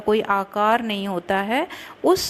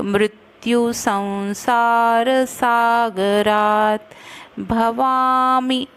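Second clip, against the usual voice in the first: careful Sanskrit chant; slow verse recitation; much slower than usual. Shown as a demonstration. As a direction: recite the line slowly, syllable by syllable. Recites verse line slowly.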